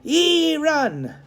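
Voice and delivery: high, loud Irish policeman command; the policeman ordering Tom away; Irish cop order. Acted. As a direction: send the boy off sharply. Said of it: "the man ordered Tom".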